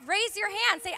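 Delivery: usual speaking voice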